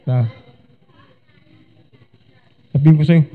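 usual speaking voice

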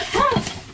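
a sudden high-pitched giggle; amused at her own dig at parents, high giggle; high laugh. Real, not acted.

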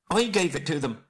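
high squeaky little hobbit voice; thin high-pitched squeak; frightened squeak. A narrator's reading. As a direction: squeak out a confession. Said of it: "squeaked Bilbo".

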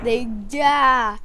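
usual speaking voice

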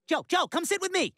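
high, urgent plea to come over; calling out. Acted. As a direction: compete for a friend's attention. Competing to call a friend over.

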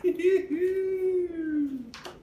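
high playful shout-laugh; high laughing yelp. Real, not acted. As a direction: burst into a gleeful squeal. After voicing the goodbye.

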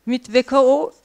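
normal talking voice

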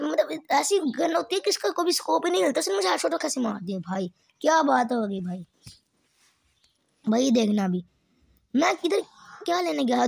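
ordinary delivery

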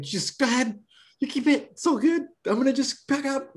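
high, hurried reassurance; flustered reassurance. Retold or imitated. Salesman flustered, telling the woman to keep the slick. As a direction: talk fast to get out.

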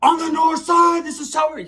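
hammed-up high yell; mock gang yell. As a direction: scream it like a drunk cousin. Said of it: "screaming at the top of his lungs".

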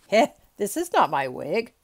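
loud, startled objection; exclaiming. A narrator's reading. Judge finding the wrong wig on his head, exclaiming. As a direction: burst out loudly at the discovery. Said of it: "he exclaimed".

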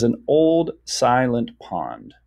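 slow, measured reading; deliberate teacher's reading; syllable by syllable. Shown as a demonstration. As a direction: read slowly, each syllable given room. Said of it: "little dashes between each syllable".

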